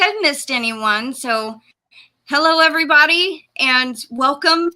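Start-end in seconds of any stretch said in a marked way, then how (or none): 2.3-3.5 bright fast excited greeting. Real, not acted. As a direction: burst out gleefully. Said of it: "I am so excited".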